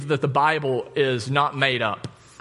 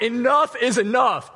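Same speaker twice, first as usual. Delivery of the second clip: greatly annoyed; 6 semitones higher than usual. Retold or imitated. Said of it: "greatly annoyed, was like".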